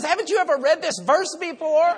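high, loud, incredulous cry; overjoyed retort. Retold or imitated. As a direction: shout it as an elated new convert. Retold convert's overjoyed reply.

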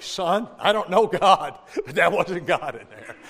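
laughing, loud and slow; a dad's dry verdict, half laughing; imitating his father. Father on church steps telling son, laughing. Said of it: "And he's like".

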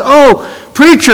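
excited greeting. Retold or imitated. Grateful nurse runs up.

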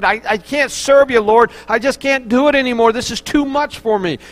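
high, worked-up cry of giving up; acted-out desperation in prayer; desperate plea. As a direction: plead that you cannot go on. Preacher acts out Paul's desperate prayer.

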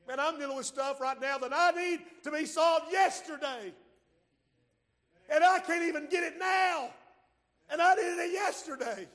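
high, loud and urgent; fervent preacher shout; preaching shout. Real, not acted. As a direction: shout it out to the church.

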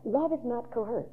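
ordinary talk, nothing marked

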